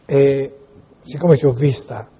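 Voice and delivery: normal talking voice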